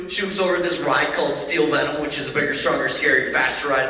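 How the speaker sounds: ordinary delivery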